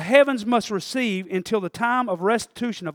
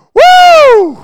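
joyful whoop; 18 dB louder than usual. Retold or imitated. Joyful shout in heaven.

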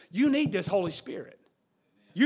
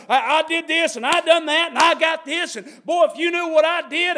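boastful; 6 semitones higher, 9 dB louder than usual. Acted. Churchgoer boasting of deeds.

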